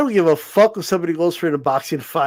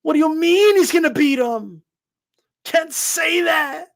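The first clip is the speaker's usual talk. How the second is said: much higher whiny outraged fan rant; mock outraged patriot; 10 semitones higher than usual. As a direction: play a fuming flag-waver who feels betrayed.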